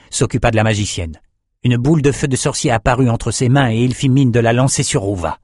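usual speaking voice

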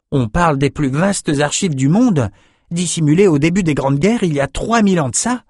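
put-on young man boasting of a marvel; eager young Bannon. Bannon telling drinkers about the archives, eager high voice.

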